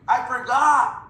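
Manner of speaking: high, loud, giggling confession; laughing admission. Real, not acted. Sheepish coach laughs at forgetting the comp plan. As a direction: blurt it out through a laugh.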